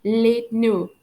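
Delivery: French "les no" ending on the vowel; silent final letters. Shown as a demonstration. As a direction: end "no" openly, without D or T. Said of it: "I don't pronounce the letters D and T".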